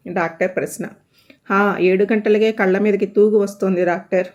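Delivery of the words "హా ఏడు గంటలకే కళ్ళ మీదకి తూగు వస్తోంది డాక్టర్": plain reading voice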